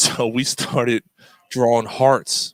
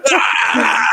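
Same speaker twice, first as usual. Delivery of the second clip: high mock burst of laughter; imitated laughing outburst; 9 semitones higher, 4 dB louder than usual. Coworker realizing it was a prank, imitated laughing outburst. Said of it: "And he starts laughing".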